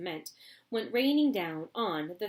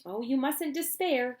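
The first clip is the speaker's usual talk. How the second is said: cheerful higher cry; cried; 6 semitones higher, 4 dB louder than usual. Acted. Wonka cheers Grandpa Joe, buoyant. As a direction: buoyant reassurance. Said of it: "cried Mister Wonka".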